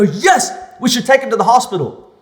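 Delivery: loud, high, jumping straight in; over-keen extra's loud delivery; eager blurted line. Retold or imitated. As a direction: shout the line out without thinking. My body just jumps into action.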